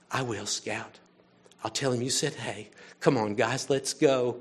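low, soft and humbled; Mister Cunningham, kneeling, softly answering Scout; gentle reply. Retold or imitated. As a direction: speak down gently to a child.

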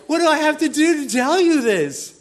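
high, loud exasperated yell; hammed-up angel at wit's end; exasperated outburst. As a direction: demand it loudly, out of patience. Angel exasperated at doubting Zechariah.

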